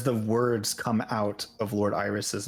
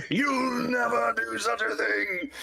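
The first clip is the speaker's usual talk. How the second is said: high, very slow outraged declaration; indignant god; 10 semitones higher, much slower than usual. Acted. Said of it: "[name] jumps up".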